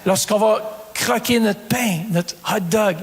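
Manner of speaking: usual speaking voice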